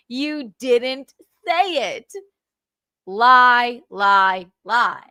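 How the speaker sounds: slow, drawn-out words, then loud punches; angry denial. Real, not acted. Man accuses Carl of changing the story, angry denial. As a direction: hammer each word separately, stepping down.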